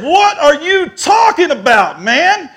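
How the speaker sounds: high, loud, drawn-out indignant yell; really mad. Retold or imitated. Accused man answering the buyer in the hall, really mad. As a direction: throw the accusation back, loud and slow. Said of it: "Now I'm really mad".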